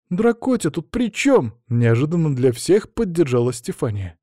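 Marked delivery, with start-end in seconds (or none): 0.1-1.5 put-on high, drawn-out girlish protest; indignant question. A narrator's reading. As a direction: ask it slowly, high and affronted. Girl joins the protest. (возмущённый вопрос высоким голосом)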